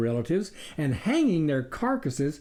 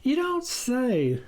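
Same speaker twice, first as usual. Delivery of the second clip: slow, higher "you don't say"; drawn out, unconvinced; musing; 10 semitones higher, slower than usual. A narrator's reading. The farmer mulling it over, slow. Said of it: "the farmer mused".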